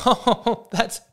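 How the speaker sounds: high, laughing through the words; laughing bartender. Acted. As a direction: crack up while you talk. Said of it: "even he's in hysterics".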